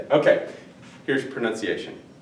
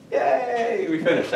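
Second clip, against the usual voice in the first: high, light little "yay"; playful cheer; 10 semitones higher, 3 dB quieter, slower than usual. Pretend. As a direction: celebrate finishing, pitched up high. Celebrates finishing the count.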